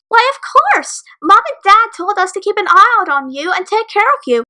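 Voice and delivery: high cheerful grinning voice; wide grin. A narrator's reading. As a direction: beam as you say it. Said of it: "Caramel responded with a wide grin".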